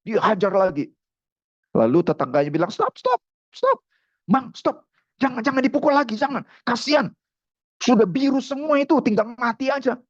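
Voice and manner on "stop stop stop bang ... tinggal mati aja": high, alarmed cries to stop; acted panicky neighbour, pitched way up; urgent pleading. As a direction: beg him to stop hitting.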